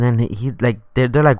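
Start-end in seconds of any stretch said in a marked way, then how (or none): none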